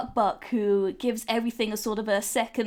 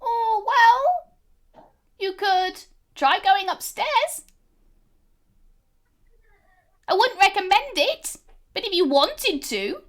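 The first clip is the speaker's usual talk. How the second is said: put-on much higher, small girl's voice; little May; 9 semitones higher, 4 dB louder than usual. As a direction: speak as a tiny proud girl. GM voicing May.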